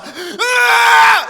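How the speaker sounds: long, high, loud bawling wail; hammed-up hideous sobbing howl; ugly crying wail. Shown as a demonstration. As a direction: let out a grotesque mock crying scream. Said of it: "quand je pleure ... ça ressemble à".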